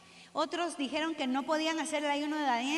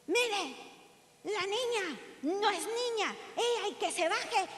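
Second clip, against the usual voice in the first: mock guard yelling; 6 semitones higher, slower than usual. School guard shouting at the mother at the gate, imitated angry yell. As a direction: bark it out like an annoyed school guard, retold. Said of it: "Y me empezó a gritar".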